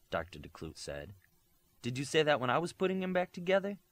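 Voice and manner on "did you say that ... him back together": fast, high, sneering retort. A narrator's reading. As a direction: throw it back at grandma with scorn. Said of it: "said to her grandmother with a sneer".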